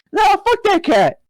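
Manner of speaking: high, slow, outraged squeal; hammed-up high outrage at the cat; mock outrage. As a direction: squeak out a mock-furious refusal. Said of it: "And I'm like".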